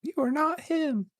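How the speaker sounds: high-pitched mock taunt; squeaky teasing. Teasing a friend.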